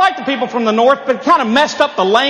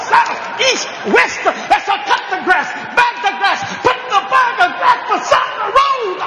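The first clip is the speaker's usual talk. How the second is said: high, booming preacher cadence; 8 semitones higher than usual. Retold or imitated. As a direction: preach the chore list like a sermon. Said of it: "his preacher voice".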